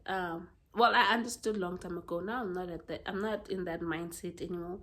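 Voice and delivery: usual speaking voice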